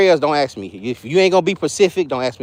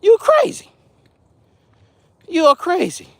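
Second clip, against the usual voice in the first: a hammed-up heavenly voice scolding a preacher; imagined Lord scolding; 10 semitones higher than usual. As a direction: play the Lord teasing him, pitched way up in disbelief. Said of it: "the Lord probably listening to me like".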